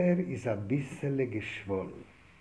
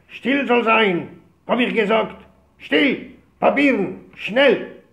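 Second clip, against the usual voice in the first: officer's shout; 6 semitones higher, 9 dB louder, much slower than usual. Retold or imitated.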